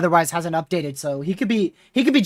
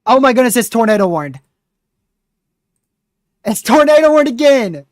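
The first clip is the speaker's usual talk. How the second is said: louder and higher, alarmed; excited alarm; 4 semitones higher, 7 dB louder than usual. Real, not acted. Streamer spotting a new tornado warning on the map, excited. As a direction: blurt out the news in alarm.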